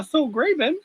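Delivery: high, squeaky sing-song dwarf voice; squeaky character voice. Acted. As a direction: pitch it way up and sing-song the orders. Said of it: "In your character voice".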